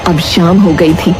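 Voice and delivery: ordinary delivery, nothing marked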